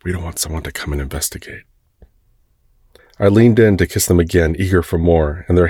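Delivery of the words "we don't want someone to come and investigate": soft, quick whisper. Acted. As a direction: hurry it out under your breath. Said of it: "they whispered against my lips".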